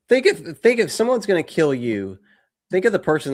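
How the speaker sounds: high, loud incredulous; ranting in disbelief; exasperated rant. Real, not acted. Ranting at plot.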